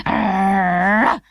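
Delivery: rough mock dog bark. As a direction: bark like a frantic little dog. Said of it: "barked Dana".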